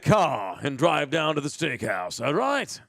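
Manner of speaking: a hint of a put-on Mid-Atlantic accent; mild Mid-Atlantic accent, heading to the steakhouse; fake English accent. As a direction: lightly play an American faking English. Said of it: "I'm gonna act like I'm an Englishman".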